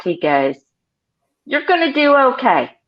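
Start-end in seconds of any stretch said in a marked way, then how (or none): 1.5-2.8 imitating an amused salesman, high pitched; pitched-up verdict, as if tickled; mock amused approval. Veteran salesman approving the new kid, mock amused. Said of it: "he laughed".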